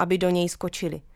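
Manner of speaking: usual speaking voice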